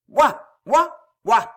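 three loud, high barks; dog barking. A narrator's reading. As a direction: bark "woof" like a dog. Barks punctuate the minister's speech.

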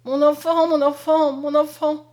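put-on loud, high, anguished calling for her child; a lioness crying for her cub; terrible cry. A narrator's reading. Mother at the blazing windows, crying out loud. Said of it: "elle n'avait qu'un cri, cri terrible".

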